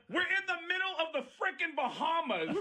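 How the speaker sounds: high, laughing comic outrage; exasperated. Real, not acted. Comic defending his iceberg prank, incredulous. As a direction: protest at how obvious it is, voice way up.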